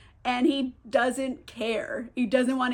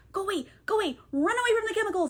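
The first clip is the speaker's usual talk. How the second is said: high-pitched acted plea to flee; imitating someone frantically shooing; urgent shooing; 8 semitones higher, much faster than usual. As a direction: shoo a creature away in a raised, pleading pitch. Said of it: "He's trying to shoo it away".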